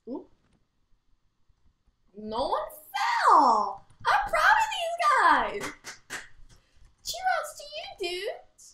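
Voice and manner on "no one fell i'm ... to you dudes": loud, very high cheering; excited cheer. Real, not acted. Cheering the other dancers after nobody fell, high and excited. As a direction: cheer them on, thrilled. Celebrating round win.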